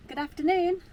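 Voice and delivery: bright, upbeat hello to camera; cheery greeting. Real, not acted. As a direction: call out a cheerful welcome. Cheery vlog intro greeting.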